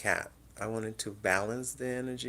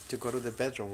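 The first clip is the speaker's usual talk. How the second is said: ordinary delivery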